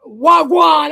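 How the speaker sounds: put-on "Wagwan" shouted loud in a strong Jamaican accent. Showing friends how strong Jamaican talk sounds, loud Jamaican "Wagwan". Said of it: "Jamaica's the only island that's, like, more strong".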